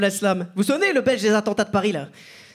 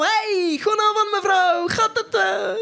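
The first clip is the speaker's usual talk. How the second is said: high, drawn-out Flemish greeting; hearty morning greeting; 9 semitones higher, much slower than usual. Acted. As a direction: greet the teacher in over-eager, high-pitched Dutch. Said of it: "j'arrive en faisant".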